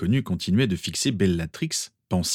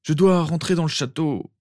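higher-pitched, urgent insistence; anxieux; 9 semitones higher than usual. Acted. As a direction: press a worried request. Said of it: "semblait anxieux". (supplique aiguë, anxieuse)

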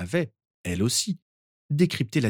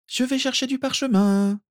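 far higher, delighted exclamation; excited cry; 3 dB louder than usual. A narrator's reading. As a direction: blurt it out thrilled. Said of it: "s'écria la mère de famille". (s'écrier, ravie)

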